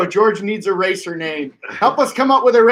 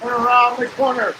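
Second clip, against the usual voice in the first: live play-by-play; 7 semitones higher than usual. Acted. Live race commentary.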